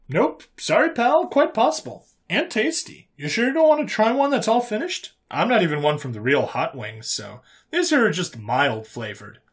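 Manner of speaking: high, chipper, casual human voice; nonchalant human character. Acted. Human offering the appalled alien a wing, nonchalant. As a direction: breezily brush off his horror.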